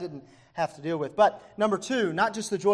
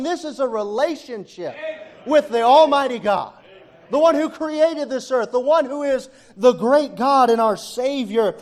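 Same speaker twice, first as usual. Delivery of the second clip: raised, high-pitched, driving sermon; fervent preaching; 7 semitones higher, 8 dB louder than usual. Real, not acted. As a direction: preach it at full force to the congregation. Preaches at full force.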